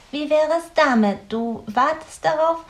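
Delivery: höhere, lautere Stimme voller Einfall; eifriges Grünäuglein. A narrator's reading. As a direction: bring den Vorschlag hell und eifrig. Grünäuglein's idea. (Grünäuglein, hoch und eifrig)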